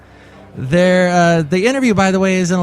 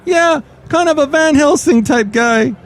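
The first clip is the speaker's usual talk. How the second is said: high, put-on hero voice; Floyd the Floor Man; 12 semitones higher, 4 dB louder than usual. Floor cleaner boasting to host of devil hunting, high-voiced. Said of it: "this is Floyd the Floor Man".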